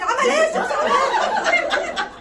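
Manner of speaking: high, loud and slow, through laughter; amazed laughing question about the sheikh; laughing disbelief. Real, not acted. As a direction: cry out the question, cracking up.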